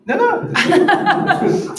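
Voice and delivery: laughing protest, high and loud. Real, not acted.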